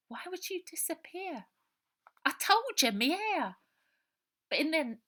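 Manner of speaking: pleading not to vanish; frightened orphan boy. Acted. Robert begging Ida, scared he'll disappear.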